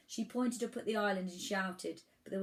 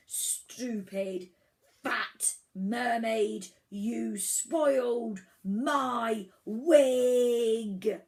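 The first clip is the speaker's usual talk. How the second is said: slow booming rage, building louder; furious island giant; 4 semitones higher, 9 dB louder, much slower than usual. A narrator's reading. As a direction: roar each word, hugely drawn out. Furious Furlstone attacks the mermaid.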